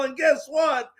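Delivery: normal talking voice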